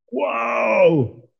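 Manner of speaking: high, drawn-out exclamation of amazement; awed whoa. Real, not acted. Preacher reacting to God's rebuke in Scripture, awestruck. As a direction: let out a long, high whoa, stunned.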